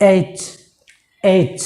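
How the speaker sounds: এইচ ending in a hard, pressed ch; correct pronunciation. Shown as a demonstration. Teacher modelling the letter after correcting it, firm ch ending. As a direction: put pressure on the final চ of এইচ. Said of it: "চর উপরে একটা pressure পড়বে".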